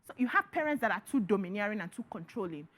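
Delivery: ordinary talk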